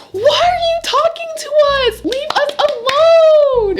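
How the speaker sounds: high, loud yell at the rude man; screaming at the man to leave them alone; angry shout. Retold or imitated. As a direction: shout him away, outraged.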